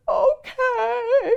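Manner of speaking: high, sobbing okay; mock crying okay. Crying mother answers daughter, high sobbing. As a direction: whimper it through tears. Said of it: "crying mother answers daughter".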